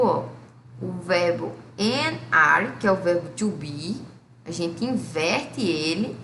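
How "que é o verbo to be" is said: ordinary delivery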